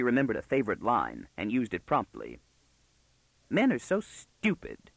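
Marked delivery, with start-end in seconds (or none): 3.5-4.9 put-on high, slow girlish disdain; scornful girl. Girl using her favorite line on the man, scornfully. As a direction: draw it out with haughty scorn.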